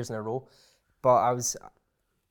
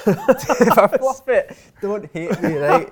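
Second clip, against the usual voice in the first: laughing; 9 semitones higher, 13 dB louder, slower than usual. Real, not acted. Laughing at own nerves.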